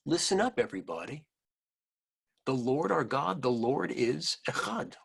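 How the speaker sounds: plain reading voice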